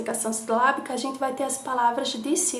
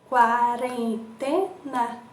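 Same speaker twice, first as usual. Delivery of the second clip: slow, quarentena split into beats; syllables drawn out; much slower than usual. Shown as a demonstration. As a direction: stretch out every syllable. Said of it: "quantas emissões de som". (quarentena bem escandida)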